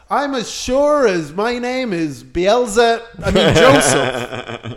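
drawn-out mock declaration, then a dropped correction; very slow, hammed-up demon giving himself away; possessed man. Demon posing as the friend slips up, hammed-up solemnity.

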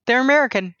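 high pitch falling on "American"; surprised realization. Retold or imitated. Host confirming the band's nationality to herself, amazed.

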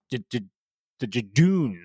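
normal talking voice

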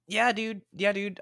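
high, bright yeah dude; excited agreement. Real, not acted. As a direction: cheer it twice.

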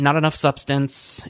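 ordinary talk

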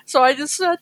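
usual speaking voice

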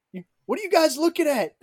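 high, loud mock challenge; confrontational challenge. Acting out stranger's challenge.

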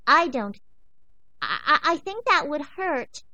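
put-on high, loud stammering young dog; scared puppy. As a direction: stammer it out, frightened but polite. Frightened pup stammers.

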